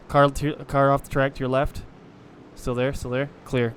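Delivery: race spotter radio. Real, not acted. Spotting a car off track.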